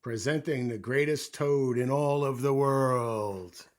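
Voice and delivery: loud, slow, drawn-out proclamation; far-off announcer. A narrator's reading. Strange voice presents Toad on stage, booming announcer. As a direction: boom it across a theater. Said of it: "A strange voice from far away said".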